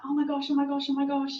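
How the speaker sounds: high, rushed panicky oh my gosh; imitating panic. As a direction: fret breathlessly. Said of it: "and it was like".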